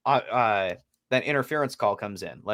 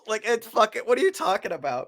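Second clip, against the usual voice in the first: higher-pitched, scoffing disbelief; incredulous mock; 8 semitones higher than usual. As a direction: scoff at the absurd excuse. Mocking the league's excuse.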